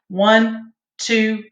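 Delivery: steady counted beats, one per second; rhythmic count-off. Shown as a demonstration. Choir teacher counting breathing beats for students, steady rhythmic count. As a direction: keep the beat for the class like a choir teacher. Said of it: "So we're gonna breathe in".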